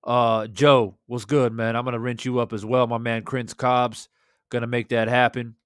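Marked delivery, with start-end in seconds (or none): none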